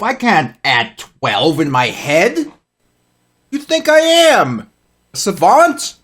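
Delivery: mock indignant. Real, not acted. Mock indignation about doing math.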